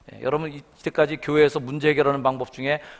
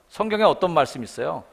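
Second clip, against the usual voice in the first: ordinary delivery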